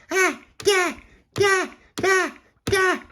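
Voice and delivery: put-on very high baby squeals; attacking baby. A narrator's reading. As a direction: squeal with each stamp. Baby jumps on tentacle.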